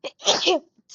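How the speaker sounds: loud sudden coughing fit; sharp explosive cough. Real, not acted.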